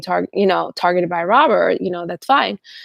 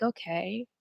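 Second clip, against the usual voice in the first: skeptical brush-off; 8 dB quieter than usual. Retold or imitated.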